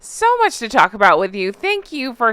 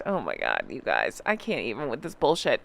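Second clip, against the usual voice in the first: exasperated; 10 semitones lower, 9 dB quieter than usual. Real, not acted. Exasperated aside to viewers.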